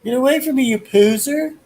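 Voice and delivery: very high cartoon voice; cartoon character voice. Quoting the Baby Yoda video, very high cartoon voice. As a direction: squeak out an insult. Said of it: "when he says".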